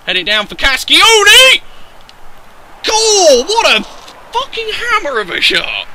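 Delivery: high, loud cry of triumph; goal celebration. Real, not acted. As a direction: erupt as the ball goes in. Goal scored in the game.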